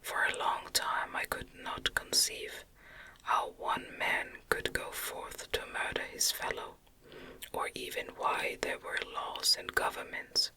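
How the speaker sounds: ordinary delivery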